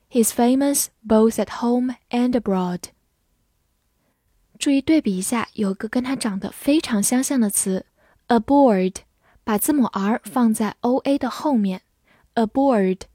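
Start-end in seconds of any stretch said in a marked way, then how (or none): none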